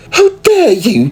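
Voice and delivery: high, loud indignant cry; high outraged squeal. Acted. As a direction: clutch your pearls and shout it. Offended lady snapping back.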